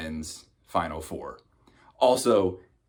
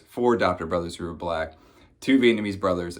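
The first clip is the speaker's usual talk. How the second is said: ordinary talk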